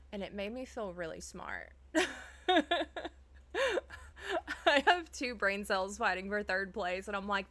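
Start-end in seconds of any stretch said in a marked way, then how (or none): 1.8-4.6 squealing laugh. Real, not acted. Laughs at herself.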